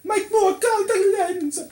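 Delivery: high, loud, hammed-up Italian accent; bad Italian accent. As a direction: wail it in a terrible mock-Italian voice. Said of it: "speaking like she had a very bad Italian accent".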